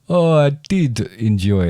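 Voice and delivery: slow Transylvanian vampire voice; Transylvanian accent. Acted. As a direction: drawl it out like Count Dracula, Transylvanian. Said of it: "My vampire voice?".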